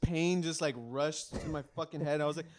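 ordinary delivery

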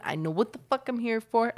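ordinary talk

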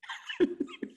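amused laugh. Real, not acted. Laughing at the punchline.

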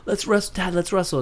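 put-on eager kid. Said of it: "the way it goes usually for me is".

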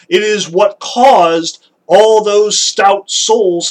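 grave emphatic stress; emphatic slow. Shown as a demonstration. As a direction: drive home the horror word by word. Stressing the horror of rage.

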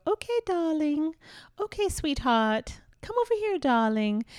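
sweet English mum. Retold or imitated. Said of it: "moms from England, they go".

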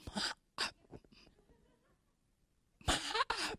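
labored, one-by-one gasps; gasping breaths. Shown as a demonstration. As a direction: imitate struggling for each breath. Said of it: "satu-satu napasnya gini".

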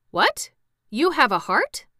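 high, loud astonishment; surprised. A narrator's reading. Scarecrow astonished by Jack's claim to a heart, surprised. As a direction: leap up in pitch, amazed.

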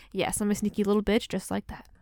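ordinary talk

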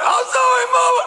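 hammed-up childish crying to dodge the whooping; fake crying. Boy begging his mama with hammed-up crying. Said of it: "cry, like, cry".

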